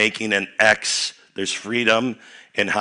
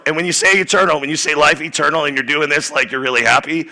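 excited coaching; 6 semitones higher, 5 dB louder than usual. Real, not acted. Excited instruction to congregation.

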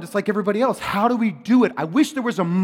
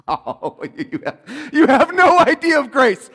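louder, higher, laughing through it; laughing exclamation; 7 semitones higher, 7 dB louder than usual. Real, not acted. Preacher marvels at grace to the congregation, laughing loudly. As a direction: burst out laughing at the wonder of grace.

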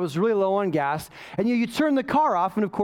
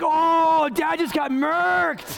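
high, loud, slow teasing with a laugh; the kids gleefully tease their dad, laughing; 8 semitones higher, 3 dB louder, much slower than usual. Retold or imitated. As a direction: crow it like a delighted kid. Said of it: "my kids are like".

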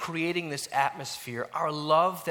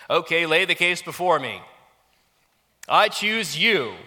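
mock judge; 5 semitones higher, 12 dB louder than usual. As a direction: play the self-appointed judge. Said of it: "slip into being the judge".

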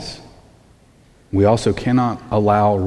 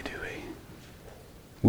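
hushed, whispered tag question; whispered aside; 17 dB quieter than usual. Real, not acted. Preacher confiding a shared failing to congregation, whispered aside. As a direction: drop right down to a whisper.

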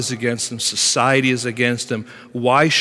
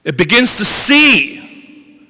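rising preaching; 12 semitones higher, 10 dB louder than usual. Acted. Preaching builds.

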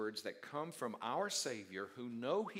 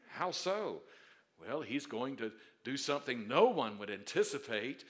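usual speaking voice

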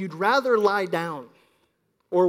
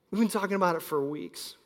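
ordinary talk

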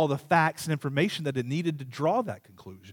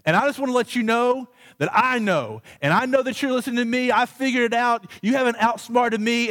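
raised, high-pitched kid's confrontation, acted out; getting louder; 8 semitones higher, 4 dB louder than usual. Child in the bathroom confronting the hidden microphone, getting louder. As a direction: act out calling their bluff, loud and high. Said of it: "I get louder and louder and louder".

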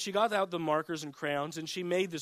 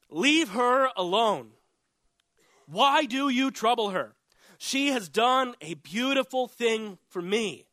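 raised, emphatic rebuke in measured words; firm defense; 5 semitones higher, 4 dB louder, slower than usual. Acted. Jesus defending Mary to her critics, firm acted rebuke. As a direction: step in firmly, slow and higher. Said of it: "Jesus stepped in to defend Mary".

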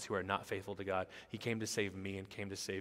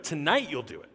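raised, higher-pitched firm correction; emphatic rebuttal; 6 semitones higher, 8 dB louder than usual. Retold or imitated. Jesus answers Peter's vow, emphatic rebuttal. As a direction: cut him down with loud certainty.

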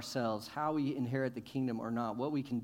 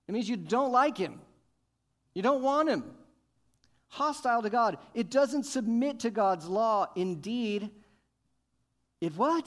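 higher, loud fiery preaching; preaching hard; 8 semitones higher, 5 dB louder than usual. Real, not acted. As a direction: drive the point home with fire. Preaching at full force.

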